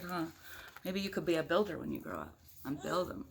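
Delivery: ordinary delivery, nothing marked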